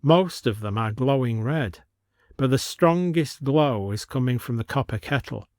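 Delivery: plain reading voice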